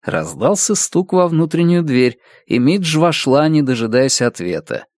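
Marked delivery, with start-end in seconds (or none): none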